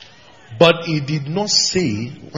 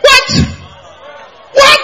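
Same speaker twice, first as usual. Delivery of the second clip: astonished shout; 10 dB louder than usual. Real, not acted. Preacher's astonished exclamation.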